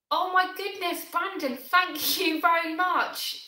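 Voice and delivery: high, slow, gasping thanks with a laugh; overwhelmed surprise. Real, not acted. Streamer thanking a viewer for a super chat, surprised and slow. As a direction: caught off guard by a kind gift.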